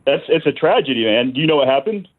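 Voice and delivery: ordinary talk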